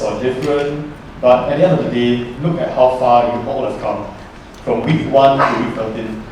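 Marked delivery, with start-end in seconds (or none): none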